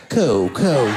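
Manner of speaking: low, drawn-out "cool, cool"; a little girl shrugging off her mom, deep and unbothered; cool dismissive kid. Retold or imitated. Child's knowing dismissal.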